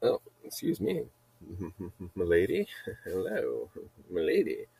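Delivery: soft, chuckling mock-gallant mannerism; hushed impression, playfully courtly; Newman in Jurassic Park. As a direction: do a quiet, creepy movie-character bit. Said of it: "Newman freaking Jurassic Park mannerism".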